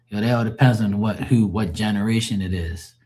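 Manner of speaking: normal talking voice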